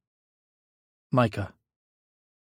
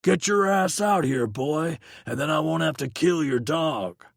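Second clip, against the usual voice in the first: threatening man yelling through a door; the ex threatening from outside, a yelled threat; menacing yell; 6 semitones higher than usual. Acted. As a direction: bark the threat at the house. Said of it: "followed by someone yelling".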